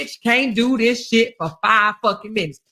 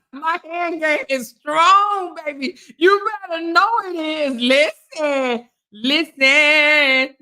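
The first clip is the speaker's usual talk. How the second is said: higher, drawn-out boast; playful bragging; 5 semitones higher, slower than usual. Pretend. Streamer answering compliment on her hand game, playfully boasting. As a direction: stretch the words with hammed-up pride.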